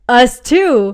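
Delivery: retold loud reply to the stranger at the door; loud disbelieving reply. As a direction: fire it back in shock.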